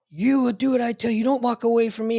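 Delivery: mock outraged chief; retold yelling. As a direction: imitate a chief yelling orders, pitched well up. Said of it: "he starts yelling at me".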